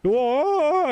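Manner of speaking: very high, loud whoa; retold alarm. Watching the guild argument explode, loud high whoa. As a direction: cry out as the fight breaks loose. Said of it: "And, like".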